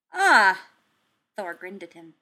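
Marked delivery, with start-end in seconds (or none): 0.1-0.7 a loud, high burst of glee; a big hearty Ah of delight; delighted exclamation. Acted. Thor answering Steve, loudly pleased.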